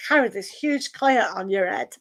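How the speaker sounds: normal talking voice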